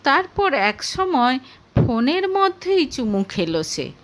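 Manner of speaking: plain reading voice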